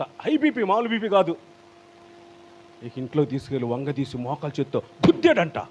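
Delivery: ordinary talk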